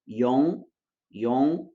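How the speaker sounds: yong, first syllable like tio; like Portuguese tio. Shown as a demonstration. Teacher demonstrating first syllable, pron. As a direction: yong ending in a nasal like tio. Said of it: "como se fosse um som de tio na primeira sílaba".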